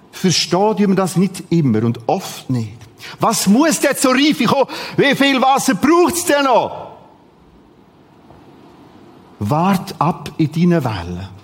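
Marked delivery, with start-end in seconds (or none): 3.2-6.9 exasperated pleading shout; exasperated outcry. Acted. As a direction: cry out impatiently at God. Desperate disciples voiced in sermon.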